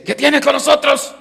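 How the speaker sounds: high, loud acted demon cry; demon's shout. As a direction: shout it out as the demon confronting Christ. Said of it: "le grita y, y dice". (grito agudo del demonio)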